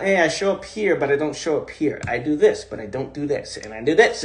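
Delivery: mock inner voice. Mocking a fragmented self-view.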